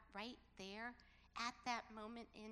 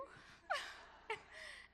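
high, breathy burst of laughter; high amused laugh; real laugh. Real, not acted. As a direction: laugh at yourself. Laughing at the memory.

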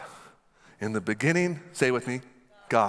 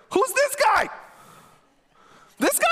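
much higher, unimpressed put-on voice; scoffing David; 4 dB louder than usual. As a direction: sneer at the giant as no big deal. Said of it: "He's like".

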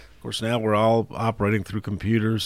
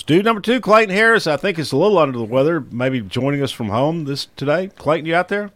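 radio announcer; 7 semitones higher, 5 dB louder than usual. Acted. Radio host introduces guests.

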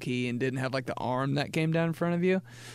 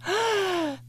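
excited gasp. Pretend. Gasp at dog photo.

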